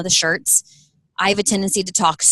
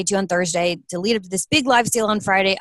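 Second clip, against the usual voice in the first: ordinary talk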